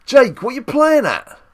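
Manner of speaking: high, loud mock scolding; mock telling-off. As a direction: call him out in mock outrage. Mock-scolding a player called out.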